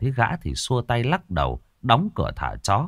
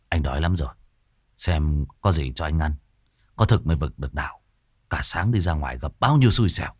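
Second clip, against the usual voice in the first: lower, tired man's voice; weary husband; 6 semitones lower, faster than usual. A narrator's reading. Exhausted husband lying on the bed, complaining. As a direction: sigh it out, worn down. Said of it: "Bác mệt mỏi nằm vật ra giường".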